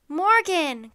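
loud, high joyful cry; cried out. Acted. Annie crying out to Morgan at the treehouse window, loud. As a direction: call her name up to the window. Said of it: "cried Annie".

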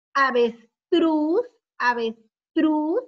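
slow, emphatic AVESTRUZ, rising at the end; drawn-out model word. Shown as a demonstration. Teacher showing pupils a word with tru, slow and emphatic. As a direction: sound out "Avestruz" with a strong, lifted last syllable.

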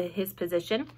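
ordinary delivery

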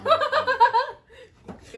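loud high cackle; high laugh. Real, not acted. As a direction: crack up laughing.

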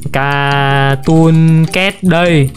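very slow, low, stretched-out name; slow drawn-out announcing. Pretend. Player showing off Cartoon Cat, slow drawn-out announcing.

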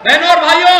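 shouted, high greeting to the crowd; rally shout. Real, not acted. Politician rouses the rally crowd, full shout.

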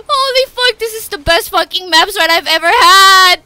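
high, loud, giddy shouting; real euphoric hollering; ecstatic yelling. Real, not acted. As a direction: scream your disbelief at the win. Celebrating best run ever.

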